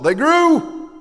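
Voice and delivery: loud, high exclamation; triumphant shout. Real, not acted. Preacher answering his congregation on the result, triumphant shout. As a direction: proclaim the result at full voice.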